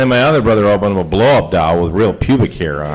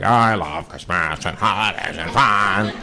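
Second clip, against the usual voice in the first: slow, slurred drunk imitation; drunk dad; 4 dB quieter, slower than usual. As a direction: drag the words out like a wasted father. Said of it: "Pretty fucked up".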